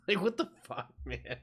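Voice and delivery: real laugh through the words; amused exasperation; chuckling what the hell; laughing disbelief. Real, not acted. Laughing at the absurd video.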